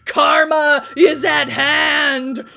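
slow, loud, otherworldly shout; booming villain proclamation. Acted. Saja proclaiming doom to the teens, slow booming shout. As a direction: boom it out like a dark prophet.

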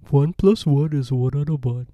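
goofy, loud dimwit impression; dopey character voice. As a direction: put on a big dumb lummox voice. Quoting the dim cartoon character Ed.

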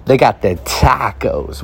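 slow, drawn-out relish over tacos; slow, pleased taco brag; savoring. Real, not acted. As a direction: linger on it like a treat.

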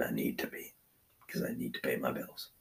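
murmure-le comme un aveu; whispered aside. Real, not acted. Confessing aside, dropped to whisper.

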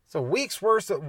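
ordinary talk, nothing marked

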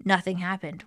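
plain reading voice

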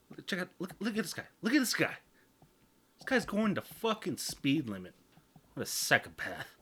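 high-pitched, worked-up rant at a driver; mock road rage. Acting out road-rage thoughts.

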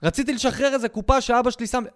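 ordinary talk, nothing marked

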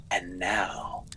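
whispered, drawn-out title; spooky whisper. Acted. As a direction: breathe the title like a ghost story. Announcing a scary story title.

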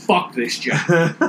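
put-on slow, drawn-out drunken brush-off; drunk fed up. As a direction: drag it out like you're wasted. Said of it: "I was all about saying".

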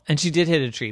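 ordinary delivery

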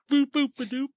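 silly mock noises. Imitating Leon's silly noises.

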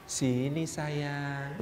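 a temptress calling softly to Yusuf; seductive call. Retold or imitated. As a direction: lure him in, drawn out and hushed. Master's wife seduces Yusuf.

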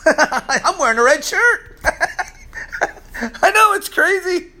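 laughing while telling it, pitch high. Real, not acted. Preacher laughing at himself for wearing red near a bull. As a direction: giggle through the words, amused.

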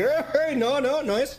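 high, squeaky little nose voice; squeaky mock voice. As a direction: play a tiny voice refusing. Voicing the nose seeing flour.

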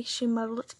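ordinary delivery, nothing marked

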